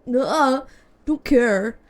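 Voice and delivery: loud bratty "don't care". Real, not acted. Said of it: "that was so teenage of me".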